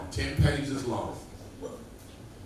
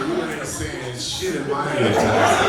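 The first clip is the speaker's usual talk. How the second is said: slow, louder defiant refusal; mock defiant yell; 4 semitones higher, 9 dB louder, slower than usual. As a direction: refuse the boxer loudly. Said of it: "I said".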